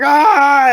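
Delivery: hammed-up fright as the dead thing grabs him; mock scream. Sean grabbed from the water, short mock scream of fright. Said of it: "And he's like".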